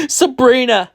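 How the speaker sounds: high, loud overjoyed cry; crying happily. A narrator's reading. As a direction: cry out with relief, pitch way up. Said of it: "he cried happily".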